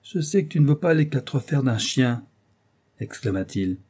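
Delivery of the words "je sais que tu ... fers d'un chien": exasperated, only slightly raised voice; mild exasperation. Acted. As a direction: let a little exasperation show. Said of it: "Beaumarchais était exaspéré".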